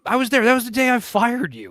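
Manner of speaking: high mock imitation of a boss; imitating character. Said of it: "Yeah, he just said it".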